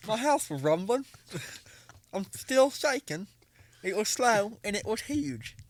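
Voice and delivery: high, slow, exaggerated British accent; bad British accent. Redoing the witness quote on request, hammed-up British accent. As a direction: ham up a bad British accent, drawn out slowly. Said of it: "just say that with bad teeth".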